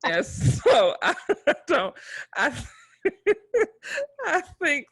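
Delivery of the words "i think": laughing cry. Real, not acted. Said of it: "sorry, sorry, everybody".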